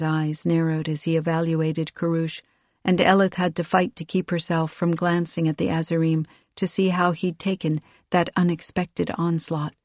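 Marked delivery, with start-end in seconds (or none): none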